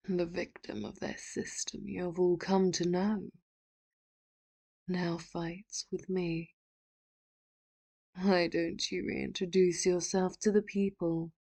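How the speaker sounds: projected, commanding address to a whole nation; grand public speech to the people; villain's broadcast speech. Acted. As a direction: orate like a villain going live on air.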